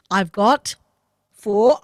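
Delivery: ordinary delivery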